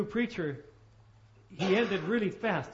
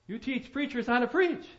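higher, louder delighted disbelief; amazed question; 7 semitones higher, 6 dB louder than usual. Retold or imitated. Ben Hooper to Craddock, voiced higher and louder in amazement. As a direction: bounce up in happy surprise.

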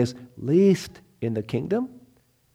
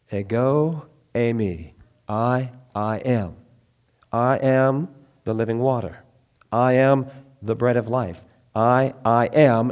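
slow, weighted I am; a solemn emphatic declaration; 2 semitones lower than usual. Acted. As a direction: lean on each I am. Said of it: "emphatic every time".

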